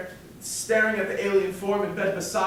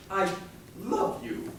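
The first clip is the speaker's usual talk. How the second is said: "love you" lifted into a soft questioning rise; 7 dB quieter, slower than usual. Retold or imitated. Man at the altar, tentative questioning rise. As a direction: say "I love you" as if asking, unsure. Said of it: "phrase it as a question".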